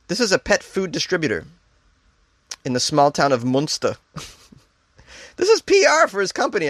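normal talking voice

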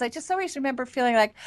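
ordinary talk

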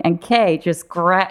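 ordinary delivery

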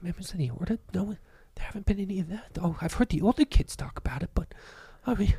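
put-on high hushed kid whisper; breathless awe, kept secret. Orphan kids whispering in awe about the Order. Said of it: "they're like".

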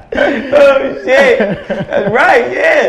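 high, loud words through laughter; laughing. Real, not acted. Host reacts to his joke, laughing loudly. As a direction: keep cracking up as you talk.

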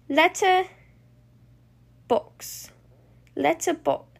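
halting, word by word; puzzling it out. Acted. Bluey piecing two clue words together, hesitant. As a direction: think aloud slowly with long gaps.

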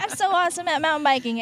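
hammed-up boasting in someone else's shoes; pretending to be guest. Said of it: "I'm pretending to be you".

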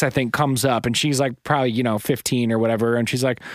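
usual speaking voice

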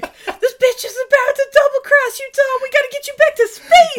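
high, loud, hammed-up valley-girl voice. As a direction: warn Dom in a shrieking over-the-top girl voice. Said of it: "they pull it off and they're like".

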